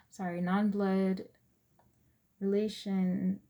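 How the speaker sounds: ordinary delivery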